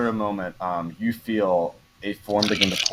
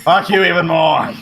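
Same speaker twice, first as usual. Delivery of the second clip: louder, higher spat insult; angry retort; 8 semitones higher, 6 dB louder than usual. Acted. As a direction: spit it back at her. Asmonis retorts.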